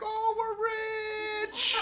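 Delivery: high, slow, dreamy delight; rich. Retold or imitated. Narrator seeing pesos fly out of the ATM, high and slow. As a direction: savor the moment. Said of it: "And I'm like".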